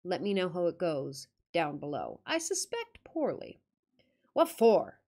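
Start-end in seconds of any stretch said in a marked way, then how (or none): 4.3-5.0 a hint of a gruff old countryman growling; sulky growl. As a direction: growl it out sulkily, louder. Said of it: "growled he sulkily".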